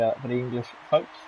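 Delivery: usual speaking voice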